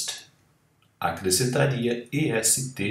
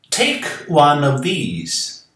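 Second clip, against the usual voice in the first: kind hostess; 5 semitones higher, 6 dB louder, slower than usual. A narrator's reading. Hostess offers sweets.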